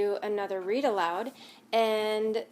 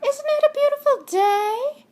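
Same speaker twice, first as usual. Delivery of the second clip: much higher, louder friendly call; tumbleweed calling to Hank, cheerfully; cheery tumbleweed calling out; 9 dB louder than usual. Acted. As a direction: sing out a bright hello. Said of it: "Rosie the tumbleweed called out".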